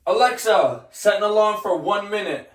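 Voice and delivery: loud call to the speaker. Real, not acted. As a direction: call across the room, high. Calling to Alexa from bath.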